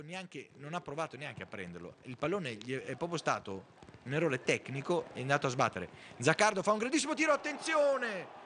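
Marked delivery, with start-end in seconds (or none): 6.2-8.4 excited shot call. Real, not acted.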